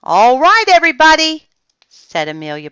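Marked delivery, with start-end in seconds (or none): 0.0-1.5 loud, high call to the group. A narrator's reading. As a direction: gather the whole yard. Teacher calling the children.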